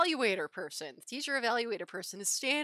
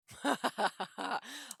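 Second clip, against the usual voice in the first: real run of quick giggles; string of light ha-ha chuckles; amused laugh; 6 semitones higher than usual. Real, not acted. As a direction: laugh freely, rising in pitch. Laughing before next story.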